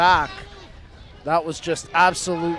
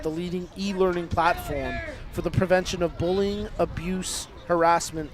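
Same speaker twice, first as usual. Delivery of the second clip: ad read; 5 dB quieter than usual. Acted. Reads a sponsor message.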